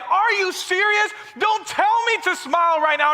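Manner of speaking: hammed-up angry friend losing it; mock outburst. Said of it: "She was like".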